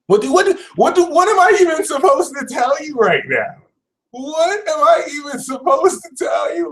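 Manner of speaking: high, strained, incredulous voice; exasperated rant. Pretend. Reader ranting at Capricorn, high and exasperated. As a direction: plead in mock disbelief.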